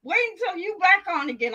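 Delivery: retold sassy clapback; sassy comeback. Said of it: "I was like".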